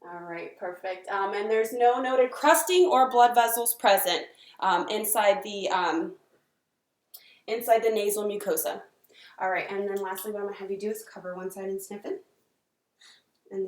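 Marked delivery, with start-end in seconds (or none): 0.0-8.8 clinical dictation. Acted. Dictating exam findings aloud.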